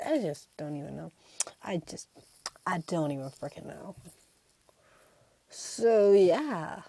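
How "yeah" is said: normal talking voice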